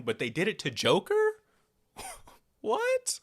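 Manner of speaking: high, incredulous "What?"; laugh, then squeak out disbelief; shocked disbelief. Real, not acted. Outraged disbelief at the twist.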